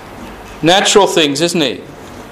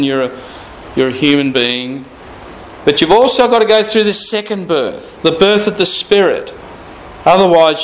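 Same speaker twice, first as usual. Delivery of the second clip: normal talking voice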